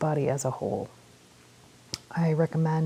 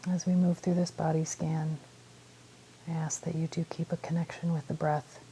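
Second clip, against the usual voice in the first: calm meditation guide with long pauses; unhurried phrases, leaving space to breathe; guided meditation; 8 dB quieter than usual. Real, not acted. Guiding listeners through a body scan, calm meditation voice.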